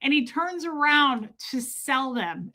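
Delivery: ordinary talk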